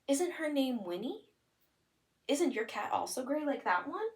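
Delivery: excited questions. Shown as a demonstration. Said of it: "it could be like".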